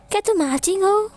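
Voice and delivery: gasp, then a high startled question; sudden spark of recognition; surprised gasp. Acted. Hero recognizing his childhood friend, gasping in surprise.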